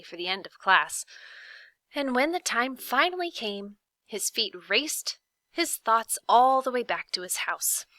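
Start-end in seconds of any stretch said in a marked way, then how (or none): none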